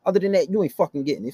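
ordinary delivery

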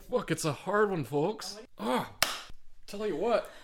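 straining through gritted teeth. Real, not acted. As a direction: force it out through clenched teeth. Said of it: "I'm gritting my teeth".

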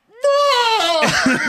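high startled yelp, laughing through it; a laughing, yelped whoa of fright; recreating the jump-scare with a laughing cry. Retold or imitated. Startled at gunman in dark.

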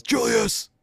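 high-pitched imitated holler; mimicked yell down the bench; yelling coach. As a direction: call the name out sharply like a coach. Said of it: "turns around and yells".

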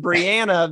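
"Brianna" with the short a of "and"; American pronunciation. Shown as a demonstration. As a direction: say "Brianna" the States way, bree-AN-uh. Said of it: "because we go the "and" over here".

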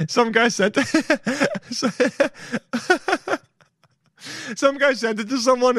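high voice broken by laughter; real laughing fit mid-sentence; cracking up. Real, not acted. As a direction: try to tell it through giggles. Laughing too hard to tell it.

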